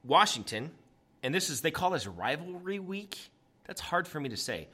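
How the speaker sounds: ordinary talk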